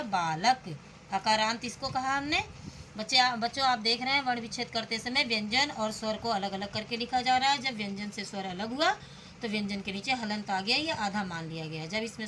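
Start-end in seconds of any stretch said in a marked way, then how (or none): none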